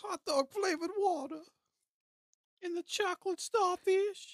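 high, loud squeaky mock voice; mock the sauce with a silly squeal. Teasing a friend about hot dog sauce, shrill squeak.

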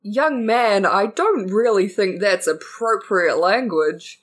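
slow, measured scolding; disapproving Santa. Acted. Santa reproving a boy's language, slow and disapproving. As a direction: reprove the child with drawn-out patience.

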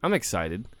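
ordinary delivery

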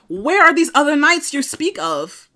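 high, loud, slow disbelief; incredulous. Retold or imitated. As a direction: demand it in mock outrage. Incredulous retort at the show.

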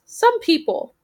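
very high sniffy bird voice; a high-pitched snooty raven; huffy raven. Acted. Offended raven voice.

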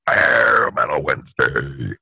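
very low, slow announcer voice; deep radio announcer. Pretend. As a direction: drop it low and drag it out like a DJ. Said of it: "that wasn't burping".

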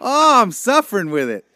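normal talking voice

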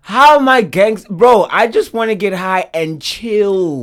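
indignant and loud; indignant outburst. Real, not acted. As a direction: push back, offended. Indignant about stereotype.

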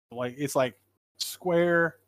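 normal talking voice

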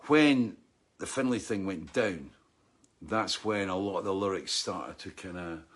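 ordinary talk, nothing marked

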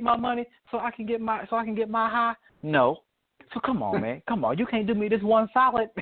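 high, fast, wheedling hustler voice; hammed-up high pleading, rushed; pleading crackhead. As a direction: beg for money like a comic junkie. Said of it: "He's like".

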